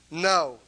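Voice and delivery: NO, loud and final; a loud, emphatic No. Real, not acted. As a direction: slam down the word No. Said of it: "it's an emphatic no".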